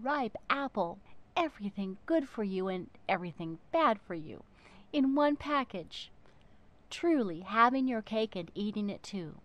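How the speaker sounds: ordinary delivery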